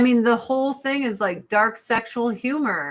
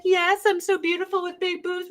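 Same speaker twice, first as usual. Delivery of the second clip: higher, vain mock voice; mocking AOC; 9 semitones higher, faster than usual. Voicing AOC as vain, mocking her in a higher voice. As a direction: play her as vain and airheaded. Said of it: "And she's like".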